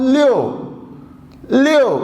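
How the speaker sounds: لیو with the stress on the final u, the i kept short; لیو, u vowel prominent; u sound in leo. Shown as a demonstration. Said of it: "منہ اور کھل رہا ہے یو کے اوپر".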